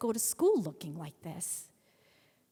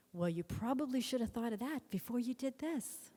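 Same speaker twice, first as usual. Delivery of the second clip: higher-pitched, pointed reproach; arch, raised-eyebrow scolding; unimpressed mother; 4 semitones higher than usual. Acted. Mother to pleading daughter after the haircut, arch reproach. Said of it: "one of those, like, single eyebrow things".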